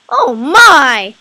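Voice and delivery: loud startled exclamation; surprised. Real, not acted. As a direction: blurt it out in shock. Reacting to a concept design.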